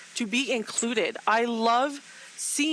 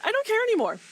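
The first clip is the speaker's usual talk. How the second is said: rising anger; 9 semitones higher, 3 dB louder, faster than usual. Real, not acted. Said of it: "I'm gonna be angry".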